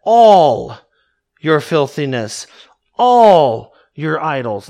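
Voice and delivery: "all" stretched long and punched loud; stress on ALL. Shown as a demonstration. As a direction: hit each "all" hard, then let the rest drop. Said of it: "I want you to notice it says all".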